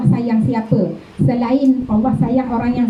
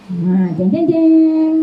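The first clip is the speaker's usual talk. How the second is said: mock dramatic drumroll; 5 semitones higher, much slower than usual. Teacher teasing the class before revealing the verse, in a playful, dramatic way. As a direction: sing out a suspense sting.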